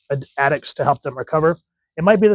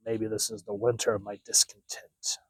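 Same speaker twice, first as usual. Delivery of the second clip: breathy whisper, drop the voice right down; whispered caption; 4 semitones lower, 9 dB quieter than usual. Acted. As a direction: hush it like a secret thought. Reading a brooding comic caption.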